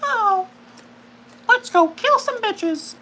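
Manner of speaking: very high, slow puppet voice; squeaky little red puppet, badly done; high bad Elmo impression. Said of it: "not a really good Elmo".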